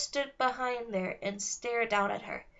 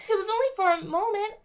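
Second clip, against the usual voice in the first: upset fairy; 9 semitones higher than usual. A narrator's reading. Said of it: "and cried some more".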